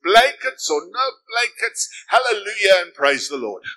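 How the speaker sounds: loud, high, slowed shout of praise; joyful outburst. Retold or imitated. Poor lady standing up mid-sermon, shouting loud joyful praise. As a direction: burst out with praise at full voice. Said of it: "she could not contain herself anymore".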